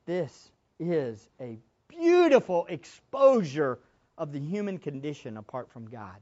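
ordinary delivery

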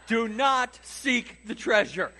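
loud, slow, laughing decree; mock solemn warning. Roommate warns him on return, loud slow laughing decree. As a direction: boom it out like an oracle, half laughing.

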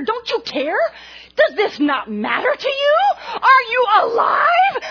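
high, loud, half-crying shouted questions; mock tearful rant. Wife demanding her husband react, hammed-up tearful shouting. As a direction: plead and yell at him all at once. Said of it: "I got madder and madder".

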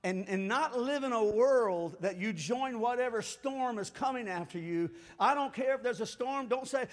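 ordinary talk

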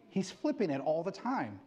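ordinary talk

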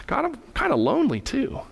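high, swooping lonely plea; lightly hammed-up lament to God; mock plaintive. Adam complains to God in story.

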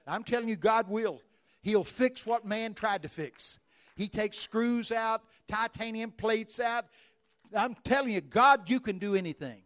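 loud, driving preacher's prayer; forceful healing prayer. Real, not acted. Preacher praying healing over the congregation, loud and driving. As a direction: pray it out with full force over the crowd.

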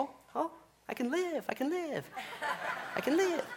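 high, soft, laughing sun-starved gasp; giddy relief. Pretend. Churchgoer greeting the sun after gloom, high and giddy. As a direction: squeak it out like you just felt sunshine. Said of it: "you're just like".

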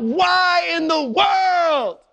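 high, loud, slow drawn-out cry to heaven; anguished cry. Acted. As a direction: cry it out like a desperate king. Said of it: "And David cried out".

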